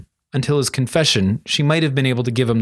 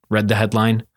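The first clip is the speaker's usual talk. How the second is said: usual speaking voice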